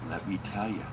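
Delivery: hushed, low and soft, fading to breath; soft secret. A narrator's reading. Conqueror sharing his secret softly with the princess. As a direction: tell it like a confidence. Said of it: "he whispered in her ear".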